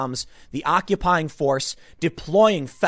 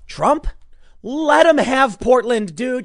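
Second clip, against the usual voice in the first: higher, louder, slow and insistent; emphatic plea; 8 semitones higher, 7 dB louder, slower than usual. Real, not acted. Commentator urging Trump to give up Portland, loud and insistent. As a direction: lean on every word.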